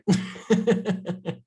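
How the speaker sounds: brief embarrassed chuckle; light nervous laugh; sheepish laugh. Real, not acted. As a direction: laugh off the apology. Laughs after apologising.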